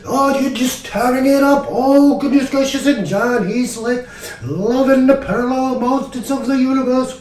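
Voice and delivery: high, excited hammed-up praise; gushing bandmate. Rick raves about the band tearing it up, imitated glee.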